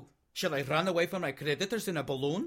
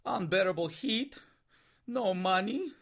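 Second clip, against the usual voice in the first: ordinary talk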